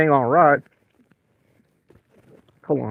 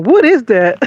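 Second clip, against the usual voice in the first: shocked; 5 semitones higher, 5 dB louder, slower than usual. Retold or imitated. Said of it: "I'm like".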